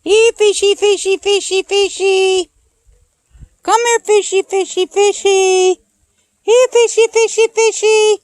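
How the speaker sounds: high sing-song calling; talking to fish. Real, not acted. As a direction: coax the fish, high and loud.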